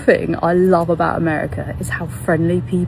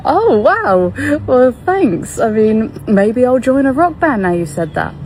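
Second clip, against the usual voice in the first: higher, acted-out delight; pitched-up, chuffed thanks; flattered reply; 6 semitones higher, 4 dB louder than usual. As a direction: retell being thrilled by a compliment. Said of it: "I was like".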